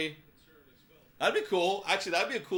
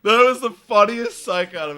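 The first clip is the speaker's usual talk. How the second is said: much louder, laughing out the words; laughing loudly; 7 semitones higher, 15 dB louder than usual. Real, not acted. Watching the replay of the umpire, laughing loud. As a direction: cackle then shout it out.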